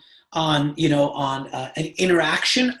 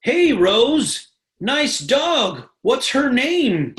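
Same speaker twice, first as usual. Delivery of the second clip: higher, slow, sugary mock chatty voice; fake friendly small talk; 5 semitones higher, much slower than usual. Stranger cold-messaging about her dog, higher slow mock friendliness. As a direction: mimic a pushy rep faking interest, drawn out. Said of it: "Build rapport".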